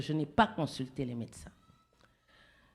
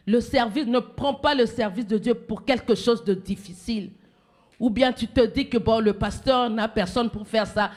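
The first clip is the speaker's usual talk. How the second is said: louder, driving pulpit exhortation; fervent preaching; 3 semitones higher, 8 dB louder than usual. Acted. Preacher urges the church to serve God, fervent preaching. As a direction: press the point on the faithful.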